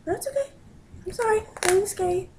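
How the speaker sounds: high soft reassuring coo; pet talk. Real, not acted. Owner apologising to her frightened cat, high soft coo. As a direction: comfort a startled kitten.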